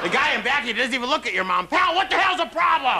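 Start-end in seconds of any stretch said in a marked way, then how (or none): 1.7-3.0 high shouted road rage; angry driver. Acted. Driver behind shouting at the comedian's mom.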